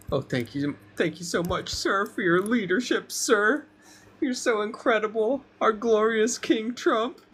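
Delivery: high, fawning flatterer's voice; sycophant voice. Retold or imitated. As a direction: gush at the king like a groveling aide. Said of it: "Ass kissing".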